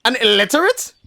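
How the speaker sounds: loud, high, indignant shout; frustrated outburst. Acted. Human snapping back at the wolves, a loud frustrated outburst. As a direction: blast it out, fed up and insulted. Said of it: "it only frustrates me more".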